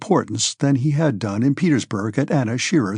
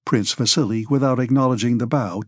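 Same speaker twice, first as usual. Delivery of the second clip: ordinary delivery, nothing marked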